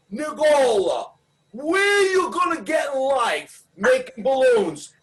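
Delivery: put-on scolding dad in an Italian accent. Father questioning his son's balloon career, Italian accent. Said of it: "my father said to me with his Italian accent".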